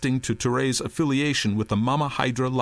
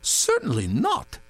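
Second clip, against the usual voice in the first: indignant denial; 7 semitones higher than usual. A narrator's reading. Touré denying.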